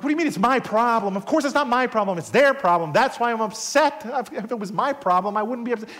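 loud, high-pitched defensive retort; indignant protest. Retold or imitated. Preacher replaying his retort to his mentor, indignant. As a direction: protest the blame, hammed-up indignation.

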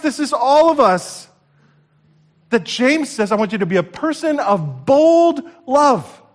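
loud, high preaching shout; preaching at full force. Acted. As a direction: call it out like a revival preacher.

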